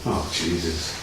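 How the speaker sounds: low, very soft exasperated aside; quiet grumble, only just audible; muttering to oneself. Real, not acted. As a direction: mutter it under your breath. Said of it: "I was talking to myself".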